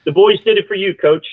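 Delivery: real emotion rising on the tribute; choked up. Real, not acted. Coach retelling his colleague's tribute to him, choked up. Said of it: "I get all choked up".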